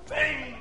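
high-pitched "bang" sound effect; imitated bang. A narrator's reading. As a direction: voice a smash against the wall. Imitating smashes on the wall.

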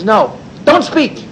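firm no. Retold or imitated. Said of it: "He says".

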